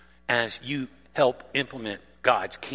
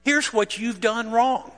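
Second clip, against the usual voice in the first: higher, louder accusing complaint; indignant Martha; 8 semitones higher, 5 dB louder than usual. Retold or imitated. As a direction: scold Jesus like a fed-up hostess. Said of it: "So she proceeds to tell Jesus".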